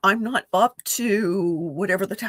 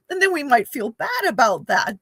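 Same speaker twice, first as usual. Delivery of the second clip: ordinary delivery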